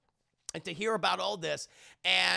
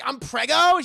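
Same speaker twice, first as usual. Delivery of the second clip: loud surprised squeak; shocked question; 6 semitones higher, 12 dB louder than usual. Retold or imitated. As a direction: gasp it out. Anna shocked.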